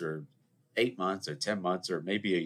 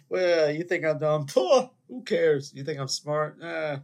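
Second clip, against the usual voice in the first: high, drawn-out scoffing; mock dismissive; 7 semitones higher, 5 dB louder, slower than usual. Host to listeners who judge him, mock dismissive. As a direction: shrug it off with exaggerated indifference.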